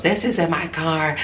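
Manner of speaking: high, slow mocking whine; mocking her voice. Retold quarrel, mocking the woman.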